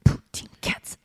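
whispered beatbox "Poutine cats". Shown as a demonstration. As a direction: breathe out the beatbox beat. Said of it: "taught to me by Radu Bandar".

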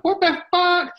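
very high-pitched mock outrage; shocked girlfriend. Girlfriend reacts to the stabbing in the bar, high-pitched shock. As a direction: squeal it as an appalled girlfriend. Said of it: "Your girlfriend was like".

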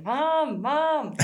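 put-on high childish calls of Mom; playful imitation of children calling out; kids calling Mom. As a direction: mimic the kids whining for Mom. Kids calling mom during call.

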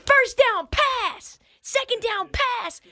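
high, very slow, mocking call; mock play call. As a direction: drag out each call in a high voice. Mocking predictable play calls.

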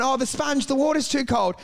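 lightly put-on precious footballer moan; whining sissy. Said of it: "they're fucking such sissies".

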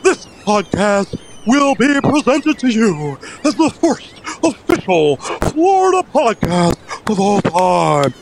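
high, booming ring announcer intro; lucha libre announcer. Acted. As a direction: build it up like a wrestling announcer. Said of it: "the wrestling announcer voice comes out".

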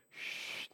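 a soft unvoiced shh like something moving; whispered shush noise. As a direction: breathe out a long quiet shh. Noise heard in the roof.

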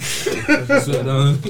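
laughing. Real, not acted. Laughing at the idea.

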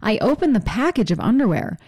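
usual speaking voice

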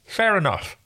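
talking around a finger, high; garbled with a finger in the mouth. Pretend. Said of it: "I can stick a finger in my mouth".

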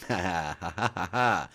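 short real laugh; amused ha ha ha. Real, not acted. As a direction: let a laugh out. Laughs at listener's joke.

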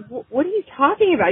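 high, quick, baffled mock protest; groggy confusion. Wife jolted awake by husband, high baffled protest. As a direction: play it half asleep and thrown. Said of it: "And I'm like".

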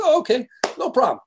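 high, quick breezy agreement; cheery okay. Retold or imitated. Corso agreeing to apologize, high quick cheer. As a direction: chirp it out eagerly. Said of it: "He goes".

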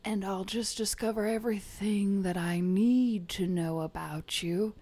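ordinary talk

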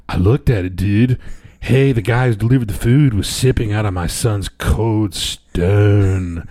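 laid-back surfer dude voice; a light surfer drawl. Retold or imitated. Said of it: "If I said".

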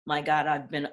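ordinary talk, nothing marked

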